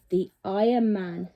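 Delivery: usual speaking voice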